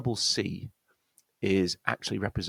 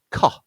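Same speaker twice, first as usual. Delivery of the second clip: "collar" with the first syllable punched louder, the last weak; first-syllable stress; 6 dB louder than usual. Shown as a demonstration. Teacher showing the stress point, "collar" stressed on first syllable. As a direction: hit the COL of "collar", then let it drop. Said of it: "stress point on the first syllable".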